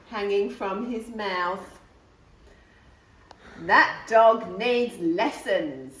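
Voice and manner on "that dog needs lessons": stern brigadier. A narrator's reading. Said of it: "said the Brigadier".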